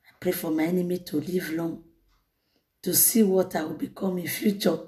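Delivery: low, soft, subdued recital; quoted quiet prayer. Retold or imitated. Preacher quoting a misguided prayer to the congregation, low and quiet.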